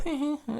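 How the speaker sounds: light, high little laugh; imitated giggle. Reviewer mimicking the end of the song, light giggle. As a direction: copy a short high giggle from the track. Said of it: "how dare you do that and go".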